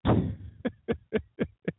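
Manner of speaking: high chuckle; laughing. Real, not acted. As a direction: run of high, light chuckles. Laughs at own sarcastic protest.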